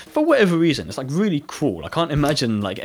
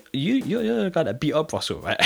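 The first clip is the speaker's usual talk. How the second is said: put-on curious girl; 6 semitones higher than usual. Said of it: "She's like".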